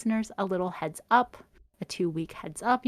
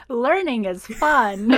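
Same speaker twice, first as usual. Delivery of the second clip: laughing; 5 semitones higher, 7 dB louder, much slower than usual. Real, not acted. Joking parody of cheesy slogan.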